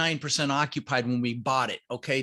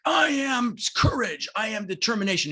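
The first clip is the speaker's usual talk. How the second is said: yelled affirmation; 7 semitones higher than usual. Retold or imitated. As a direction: yell it like a treadmill power mantra. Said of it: "Does he really yell that stuff".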